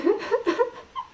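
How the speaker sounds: very high-pitched giggle; giggling. Real, not acted. Laughing at how bad her accents are, high giggle. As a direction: let out a high helpless giggle.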